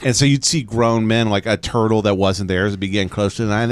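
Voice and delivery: ordinary talk, nothing marked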